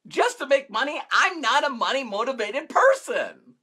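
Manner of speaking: high-pitched incredulous protest; indignant disbelief. Retold or imitated. Coach recalling doing marketing just for money, indignant disbelief. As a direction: echo it in disbelief, then protest. Indignant at his former motive.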